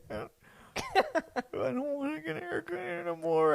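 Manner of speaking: high mock-crying kid voice; crying child. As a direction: whine it like a tearful boy in the chair. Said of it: "when I'd start crying".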